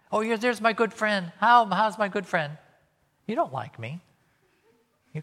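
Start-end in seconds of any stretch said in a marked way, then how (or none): 0.1-2.6 high, loud, sugary mock hello; fake friendly greeting. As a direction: gush over a friend you can't stand.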